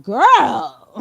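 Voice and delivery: put-on loud, drawn-out "Girl"; sassy "Girl". Reacting to the girl's rudeness, sassy. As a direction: call her out with attitude. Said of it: "I was like".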